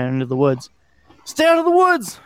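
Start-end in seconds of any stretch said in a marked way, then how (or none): 1.2-2.2 very high-pitched yell, moderately louder; narrated yell. A narrator's reading. Kid waving a flashlight at the woods, yelling. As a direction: holler a high warning into the distance. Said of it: "I remember yelling".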